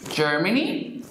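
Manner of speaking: ordinary talk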